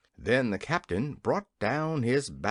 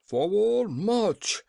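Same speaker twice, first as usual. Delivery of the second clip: loud, higher military command; commanding cry; 6 semitones higher, 4 dB louder, much slower than usual. A narrator's reading. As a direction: bark the order at the troops. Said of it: "cried all the generals with one voice".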